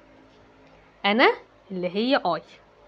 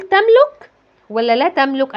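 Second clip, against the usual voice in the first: teacher quiz question; 4 semitones higher, 7 dB louder, slower than usual. Shown as a demonstration. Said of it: "teacher asks the class".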